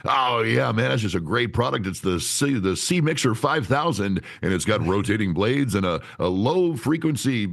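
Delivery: hammed-up announcer selling a product; radio announcer salesperson. Salesperson pitching a mixer, radio announcer voice. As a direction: put on a swooping pitchman's voice. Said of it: "a salesperson is someone who talks like this".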